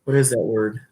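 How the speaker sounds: ordinary delivery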